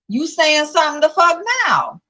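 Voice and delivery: high-pitched, exasperated comeback; indignant retort. Real, not acted. Vlogger calling out a commenter who claimed silence, indignant.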